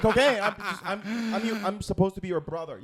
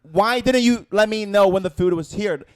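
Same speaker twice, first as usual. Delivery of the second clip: loud, heated demand; angry; 2 semitones higher, 13 dB louder than usual. Retold or imitated. Retelling how he yelled at his coworker, angry and loud. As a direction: confront the coworker in a furious voice. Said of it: "I was already angry".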